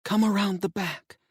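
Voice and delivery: wife giving hushed directions; wife urging. Acted. Wife's voice voiced by narrator.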